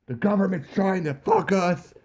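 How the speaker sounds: strained high-pitched conspiracy rant; Alex Jones raving. Retold or imitated. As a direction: rave like a paranoid conspiracy host. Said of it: "going Alex Jones raving mad".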